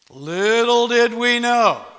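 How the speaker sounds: preacher's slow dramatic hook; dramatic slow build. Acted. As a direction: land it like a big reveal. Dramatic turn in sermon story.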